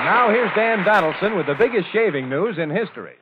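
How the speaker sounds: loud, punchy announcer lead-in; radio announcer. Acted. Host throws to the sponsor's spot, announcer style. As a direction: introduce the pitchman with a big radio flourish.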